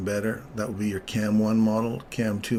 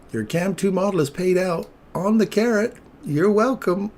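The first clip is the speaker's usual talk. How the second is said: high, loud triumphant crowing; gleeful boast; 10 semitones higher, 6 dB louder than usual. Real, not acted. Streamer crowing to viewers about the carrot. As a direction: crow about nailing the trade. Showing off a winning trade.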